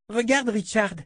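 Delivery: put-on high, eager little girl's voice; a delighted small child gushing; child voice full of admiration; little girl Rachel.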